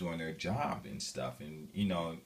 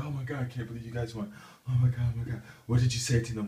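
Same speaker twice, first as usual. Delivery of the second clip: higher and louder, fast and breathless at first; amazed onlookers; 6 semitones higher, 6 dB louder, faster than usual. Retold or imitated. Bystanders ask the coach what he said, amazed and excited. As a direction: gush with disbelief.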